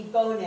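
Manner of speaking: "Bone" said as two syllables, BOH-nay; BOH-nay for "Bone", first vowel fully sounded; full OH vowel. Shown as a demonstration. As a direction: give "Bone" a round OH, then NAY. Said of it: "if it was something like that".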